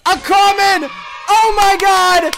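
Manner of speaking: high, loud, drawn-out yell; excited shout. Real, not acted. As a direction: shout the win slowly. Last roll decides winner.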